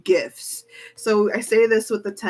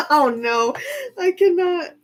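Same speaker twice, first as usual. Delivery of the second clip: overwhelmed squeal; 10 semitones higher, 4 dB louder than usual. Real, not acted. Fangirl overwhelmed after laugh.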